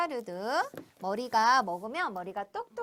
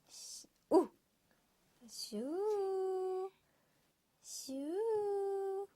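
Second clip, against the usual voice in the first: playful whoosh sound; 6 semitones higher, 4 dB quieter, much slower than usual. Acted. Imitating squeezing syrup noise.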